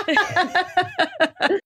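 slow, laughing exclamation; drawn out between laughs; laughing disbelief. Real, not acted. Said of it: "laughing at the story".

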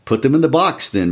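brisk instruction, starting high; directing. A narrator's reading. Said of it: "Mister Summers directed".

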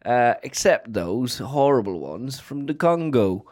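put-on a light Yorkshire accent, unhurried. A podcast host asked for Yorkshire names his favourite apes, in a light Yorkshire accent. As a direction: talk about apes like a Yorkshire local, lightly done. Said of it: "What about a Yorkshire?".

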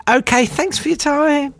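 squeaky high quick brush-off; Greenpeace caller. Retold or imitated. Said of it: "they don't talk like that".

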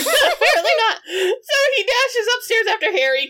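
can barely get it out for laughing; laughing through it. Real, not acted. Cracks up telling the plot.

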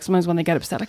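ordinary delivery

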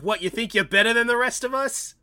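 lightly imitated New Jersey tough guy; a hint of New Jersey attitude; New Jersey accent. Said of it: "doing the New Jersey thing".

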